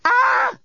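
short, very high shriek; startled high scream. A narrator's reading. Lucy startled by the fast robot, scream. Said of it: "I didn't mean to scream".

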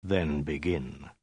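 very slow, low and soft; slow, hushed warlord order; quiet command. A narrator's reading. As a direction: give the order quietly, drawn out. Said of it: "Khan said quietly".

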